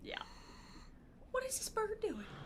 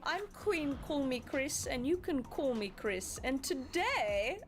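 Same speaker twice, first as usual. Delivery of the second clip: put-on proper upper-class British accent, Crown style; posh British accent; 2 semitones higher than usual. Greeting viewers like royalty, posh British accent. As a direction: play a grand royal host in British RP. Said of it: "I can't keep up that accent".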